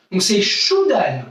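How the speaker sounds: Choudan said slowly with U as OU, shoo-DAN; model pronunciation. Shown as a demonstration. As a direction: stress the OU sound in Choudan, slowly. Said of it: "Le U se prononce OU". (Choudan lentement, le U dit OU)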